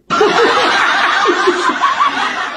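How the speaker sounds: a burst of high scornful laughter; high mocking laugh. Real, not acted. Vlogger laughing at a party's hypocrisy, high scornful laugh.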